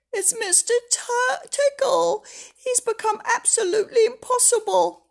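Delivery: high, loud and fretful; upset Mister Happy. Acted. Mister Happy pours out his troubles, high and upset.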